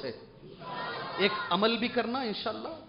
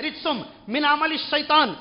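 Quran recitation; 7 semitones higher, 3 dB louder than usual. Acted.

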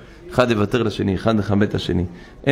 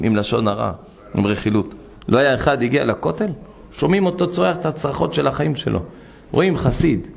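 ordinary delivery

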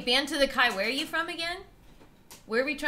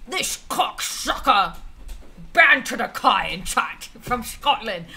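mock Scottish accent. Streamer guessing what Scots call each other, mock Scottish accent. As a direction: draw out a hammy Scottish burr. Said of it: "I know my accent's really bad".

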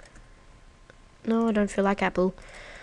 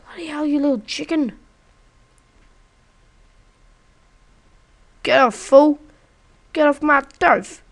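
much higher, much louder street-tough voice; mock gangster; 6 semitones higher, 9 dB louder than usual. Yelling at the chicken to get off his turf, mock gangster shouts. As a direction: tell the chicken off like a tough guy.

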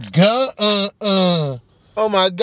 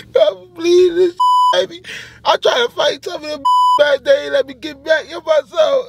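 fake crying; 9 semitones higher than usual. Rehearsing fake victim lines.

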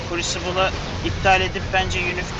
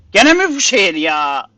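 loud, slow, fed-up complaint; annoyed yell at the game; exasperated outburst; 9 dB louder than usual. Real, not acted. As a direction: groan it out loud in disbelief. Annoyed at the same city again.

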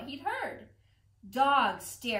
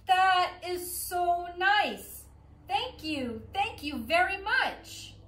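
delighted thanks; 7 semitones higher than usual. Acted. Pigeon thanking the duckling for the cookie, delighted gushing. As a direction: gush over the gift.

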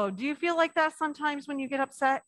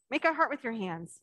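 ordinary delivery

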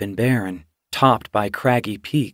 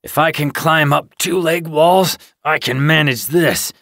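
stubborn growl, pitched higher and louder; 5 semitones higher, 4 dB louder than usual. A narrator's reading. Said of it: "he growled".

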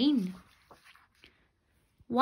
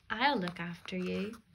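reassuring; 6 dB quieter than usual. Acted. Brother comforts his sister.

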